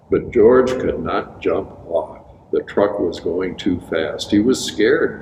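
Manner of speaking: plain reading voice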